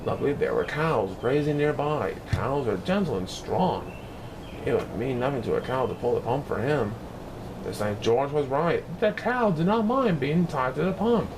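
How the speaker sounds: usual speaking voice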